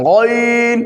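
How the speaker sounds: গইন rasped from the end of the throat; Arabic letter ghain. Shown as a demonstration. Teacher to beginners, throaty ghain demo. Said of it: "হলকের শেষ হইতে".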